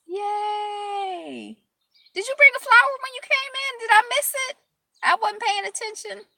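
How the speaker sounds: high, fast, squeaky play voice; mock-innocent baby talk; cartoonish high voice; playful silly voice.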